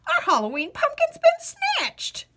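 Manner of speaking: high, wailing crone voice; a very old woman crying out in dismay; old woman lamenting her stolen pumpkin; ancient old woman. Acted. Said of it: "in a seven hundred-year-old voice".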